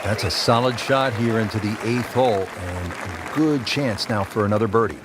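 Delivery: ordinary talk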